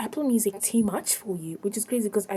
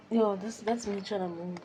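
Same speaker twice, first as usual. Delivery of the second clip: usual speaking voice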